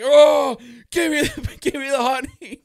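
hammed-up bear man. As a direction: play a honey-mad bear begging loudly. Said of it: "one of the members from Bear Country".

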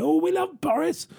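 high, gushing mock devotion; sarcastic swoon; mock adoring. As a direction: sneer at the fawning crowd. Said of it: "everyone wants to rally around him".

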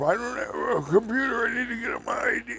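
high, fast, panicked yell; a high, flustered shout, words tumbling; frantic Okie yelling. Retold or imitated. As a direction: shriek it like the screaming Okie. Screaming Okie voiced, shout.

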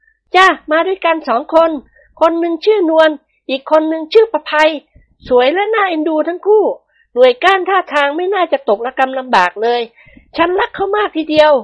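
high, gentle ladylike voice; high-voiced mistress of the house, pleased; lady of the house. A narrator's reading. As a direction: voice the madam sweetly pleased with her hair. Thada praises new maids.

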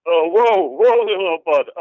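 startled skeleton calming a small creature; startled whoa. Acted. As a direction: back off in alarm, soothing the creature.

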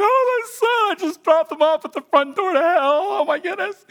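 high, loud wailing through laughing tears; blubbering like a baby. Retold or imitated. Pastor on the train after dropping off his son, blubbering high and loud. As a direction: sob it out, half laughing at yourself. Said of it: "I'm literally blubbering like a baby".

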